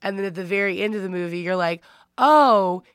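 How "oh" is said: loud, higher "oh" of realization; dawning realization. Retold or imitated. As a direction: let it land as a big reveal. Acting out the twist realization.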